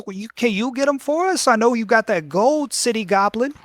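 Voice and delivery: put-on high, pleading children's voice; whining goblin kids. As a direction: beg like a bunch of kids. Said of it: "He says".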